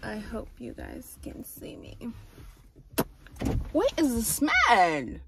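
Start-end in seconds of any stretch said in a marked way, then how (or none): 0.0-2.0 whispering. Real, not acted. Hushed aside while filming.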